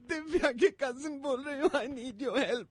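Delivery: ordinary delivery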